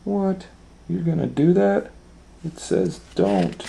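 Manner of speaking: high surprised critter voice; incredulous squirrel. A narrator's reading. Squirrel watching Goldilocks ignore the sign, high surprised squeak. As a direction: squeak out disbelief. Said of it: "the squirrel is looking at her like".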